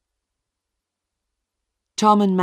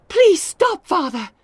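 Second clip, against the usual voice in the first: high, loud begging; pleading; 8 dB louder, slower than usual. A narrator's reading. Daughter holding her father's arms, begging high and loud. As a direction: beg him to stop, voice pitched up.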